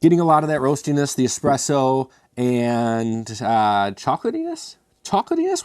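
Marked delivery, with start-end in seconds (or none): 5.0-5.6 much higher, questioning his own odd word; incredulous. Real, not acted. Reviewer doubting his word chocolatiness, mock surprised and much higher. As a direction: squeak it back in mock disbelief.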